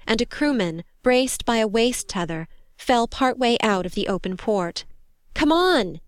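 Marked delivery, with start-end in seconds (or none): 5.3-6.0 high, urgent shout. A narrator's reading. Crewman calling to the soldier from the open port, shouted. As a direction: call her over urgently. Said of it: "he shouted".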